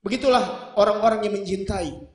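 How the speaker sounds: ordinary delivery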